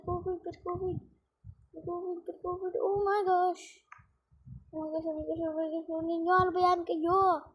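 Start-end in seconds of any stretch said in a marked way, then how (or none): none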